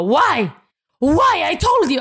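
high, loud imitated yell at her; mocking a nurse screaming at her; retold screaming. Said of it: "hon skriker på mig".

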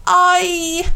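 a short pained squeal; whiny ouch. A narrator's reading. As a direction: yelp it out in a high whine. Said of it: "gnällde Pu".

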